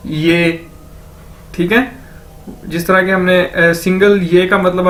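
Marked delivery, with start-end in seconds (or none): 0.1-0.7 ये as one drawn-out level syllable; pronunciation demo. Shown as a demonstration. Teacher answers how YE is pronounced, pronunciation demo. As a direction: model ये alone, held evenly. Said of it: "कैसे pronounce करेंगे".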